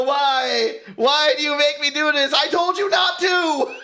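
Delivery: rising, hammed-up wail of blame; imitated tantrum, climbing in pitch; mock anguished shout. Mocks studio shouting blame.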